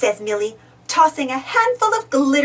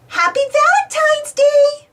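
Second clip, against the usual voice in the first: high, louder happy greeting; cheerful shout; 13 semitones higher, 6 dB louder than usual. A narrator's reading. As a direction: call it out to the whole shop. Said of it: "they shout".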